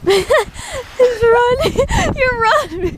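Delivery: words through giggles; laughing. Real, not acted. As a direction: laugh through each word. Laughing at the running dog.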